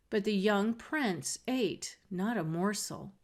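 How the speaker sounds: plain reading voice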